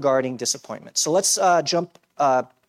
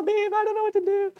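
much higher, whiny mock wail; mock crybaby whine. Pastor mimics himself whining to his wife, high mock wail. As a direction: wail it like a grown man crying to his wife. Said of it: "I'm crying in my pillow".

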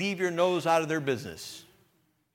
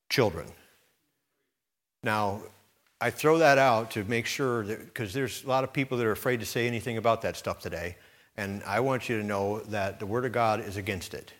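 ordinary talk, nothing marked

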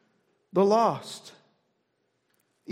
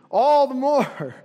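laughing; 3 semitones higher, 5 dB louder, slower than usual. Real, not acted. Laughs retelling the beggar's persistence.

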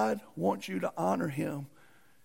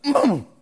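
loud, higher "mm"; imitated pained grunt; 5 semitones higher, 10 dB louder than usual. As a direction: grunt as if taking the lick. How the whipped man reacted.

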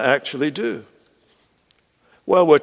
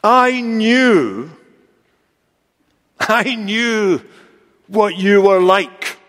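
higher, slow accusation with long pauses; aggrieved, halting accusation; accusing God; 3 semitones higher, much slower than usual. Acted. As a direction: throw it up at God, higher and drawn out. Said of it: "he's saying to God".

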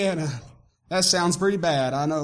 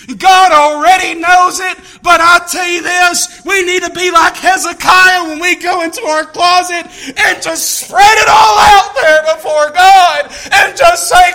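loud preaching; rising sermon fervor; 8 semitones higher, 13 dB louder than usual. Real, not acted. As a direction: urge the church on, loud. Preaching at full force.

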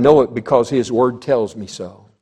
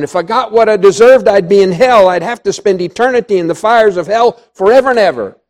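loud, raised preaching voice; full-force preaching; 4 semitones higher, 6 dB louder than usual. Real, not acted. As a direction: proclaim it forcefully to the congregation.

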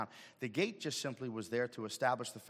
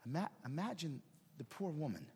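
ordinary delivery

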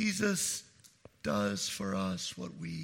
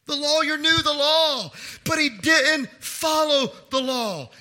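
full-force preaching; 16 dB louder than usual. Acted. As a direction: proclaim it to the whole church.

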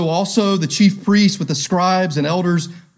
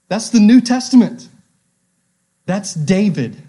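loud, high proclamation; rising preacher's cry, loud and high; preaching shout; 5 semitones higher, 5 dB louder than usual. Real, not acted. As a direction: proclaim it to the back row. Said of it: "preaching peak".

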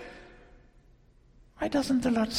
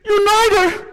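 put-on crying little girl; 12 semitones higher, 10 dB louder than usual. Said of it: "she's crying".

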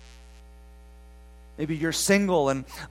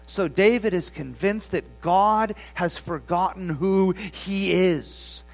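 ordinary delivery

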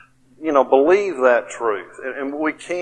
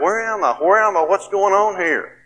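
retold screaming; 6 semitones higher than usual. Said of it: "I started screaming out".

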